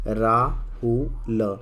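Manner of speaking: Rahul stretched very slowly, syllable by syllable; teacher sounding out Rahul slowly; drawn-out syllables. Shown as a demonstration. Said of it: "जैसे यहाँ पे देखें".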